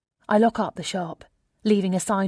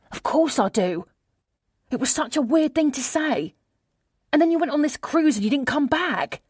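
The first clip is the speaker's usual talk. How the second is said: high, agitated friend on the phone; acted distressed best friend, pitched up; upset friend; 7 semitones higher, 4 dB louder than usual. A narrator's reading. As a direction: pour it out in a high, hurt voice.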